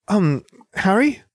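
put-on a hesitant young witch asking; Hermione voice. A narrator's reading.

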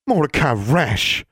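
high, drawn-out shocked rebuke; scandalised exclamation. A narrator's reading. Forbearance exclaims at Mordecai's insult, high and scandalised. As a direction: scold him by his full name. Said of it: "Forbearance exclaimed".

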